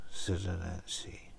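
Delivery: usual speaking voice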